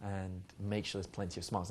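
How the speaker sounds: hushed, unhurried seduction tone; seductive. Shown as a demonstration. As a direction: speak softly with a knowing smile, as if sharing an unspoken joke. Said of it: "Seductive conversation sounds exactly like".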